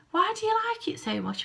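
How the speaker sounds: high, quizzical question; a lightly voiced curious partner; curious boyfriend. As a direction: ask it up high, puzzled. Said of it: "He went".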